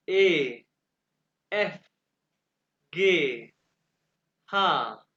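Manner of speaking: each letter from "ए" to "खू" set apart, vowels held; measured letter-by-letter model, "गे" and "खा" drawn out; German alphabet demo. Shown as a demonstration. Teacher reads the alphabet for students to hear, careful pronunciation demo. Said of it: "आपने alphabets गौर से सुने हैं".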